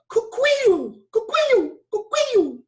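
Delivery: very high, loud falsetto cuckoo; high pitched cockatoo call. Shown as a demonstration. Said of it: "real high pitched sound going cuckoo".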